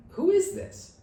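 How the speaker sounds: squeaky high incredulous question; baffled. Retold or imitated. Baffled by a stranger's odd phone greeting, very high. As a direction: act totally baffled by the caller.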